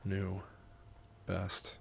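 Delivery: measured, soft reading with long pauses; memoir narration. A narrator's reading. Writer narrating grandma's visit, measured memoir reading. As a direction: read it quietly, phrase by phrase. Reads own memoir aloud in takes.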